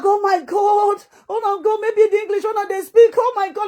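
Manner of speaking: high-pitched, gushing imitation of a show-off; mock bragging. Mocking people who come online to brag, high-pitched parody. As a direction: mimic their boasting up high. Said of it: "I just they brag".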